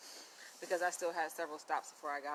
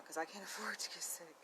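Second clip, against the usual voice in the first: a hushed, laughing whisper; whispered aside; 10 dB quieter than usual. Real, not acted. Vlogger confides to viewers she can't afford sickness, whispering. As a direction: drop to a breathy whisper with a laugh.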